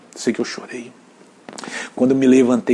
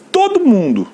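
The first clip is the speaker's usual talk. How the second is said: sudden much higher, louder cry; preaching outburst; 13 semitones higher, 6 dB louder than usual. Real, not acted. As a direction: throw it up high like a pulpit cry. Preacher rails against victim culture.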